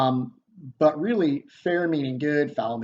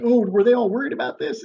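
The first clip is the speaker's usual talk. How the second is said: high, loud, quick mock worry; Duncan; 11 semitones higher, 7 dB louder, faster than usual. King asks about his captains, high mock-worried voice. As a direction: paraphrase the king in a pitched-up voice. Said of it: "And Duncan's like".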